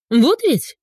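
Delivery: high, indignant exclamation. A narrator's reading. Olya reacts to her friend's video, indignant. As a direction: burst out in sympathetic outrage. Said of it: "возмущалась Оля".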